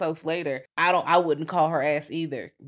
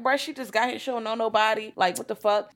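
higher, exasperated protest; indignant disbelief; 6 semitones higher than usual. Retold or imitated. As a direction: call out the rudeness. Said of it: "I'm like".

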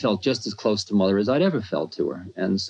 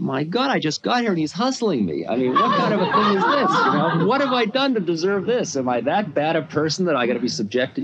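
self-pitying; 8 semitones higher than usual. Retold or imitated. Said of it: "boy, was I self-pitying and paranoid".

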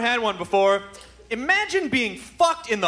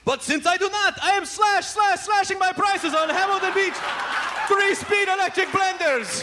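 much higher hammed-up TV pitchman voice; Crazy Gideon; 10 semitones higher than usual. Comedian imagining the ad, high manic pitchman. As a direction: sell it like an unhinged late-night huckster. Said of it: "come out and go".

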